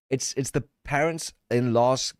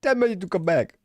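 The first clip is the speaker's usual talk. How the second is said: mock call; 8 semitones higher than usual. Repeating the shouted clip line.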